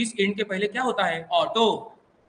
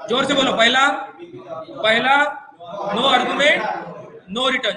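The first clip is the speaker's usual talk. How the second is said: raised, punchy classroom call; loud prompting; 4 dB louder, slower than usual. Real, not acted. Teacher urging students to answer up, loud prompting. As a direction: call it out loudly to get answers.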